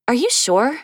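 surprised. Acted. Startled woman questions stranger.

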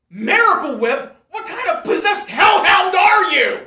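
high, loud raging yell; furious shout. Acted. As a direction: scream it in fury. Furious rant on phone.

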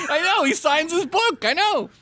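very high, exasperated squeal; laughing protest. Real, not acted. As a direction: squeal the admission through laughter. Laughing protest after being corrected.

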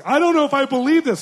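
the mom doubting the reconciliation; mother's doubt. Retold or imitated. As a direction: voice the skeptical mom up high. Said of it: "The mom's like".

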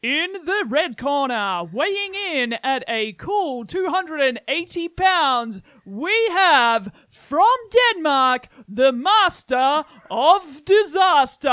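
loud, slow, high ring announcer; wrestling announcer. Acted. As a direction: announce the fighter to the crowd. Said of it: "so I'm gonna say that".

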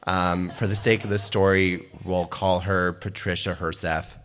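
ordinary delivery

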